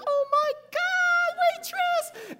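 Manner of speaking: very high, slow falsetto squeal; hammed-up swooning fan; mock falsetto gush of joy; overjoyed wife. Wife near tears, overjoyed.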